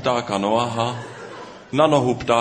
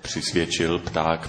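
ordinary delivery

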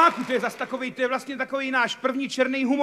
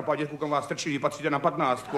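much lower, blunt nurse voice, laughing; nurse's voice; 9 semitones lower than usual. Retold or imitated. Nurse telling patient he belongs on ward fifteen, low and blunt. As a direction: play the gruff nurse scolding the patient. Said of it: "V tom tam přišla sestra".

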